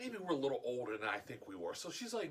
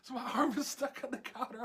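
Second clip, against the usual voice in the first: usual speaking voice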